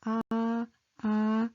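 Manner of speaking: low, easy "ah" from the throat; gentle, throaty "ah"; low and soft. Shown as a demonstration. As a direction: say "ah" softly on a level low note. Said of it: "low and soft".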